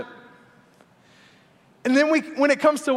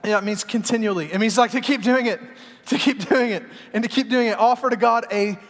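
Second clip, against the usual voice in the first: ordinary talk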